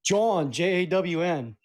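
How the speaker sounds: slow, spelled out: John, J A W N said slowly like "jahn"; Philly slang. Shown as a demonstration. Teaching his co-host the Philly word, slow and spelled out. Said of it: "That's the Philly word".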